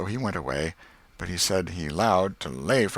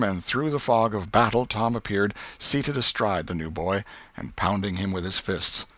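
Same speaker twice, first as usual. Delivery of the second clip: ordinary delivery, nothing marked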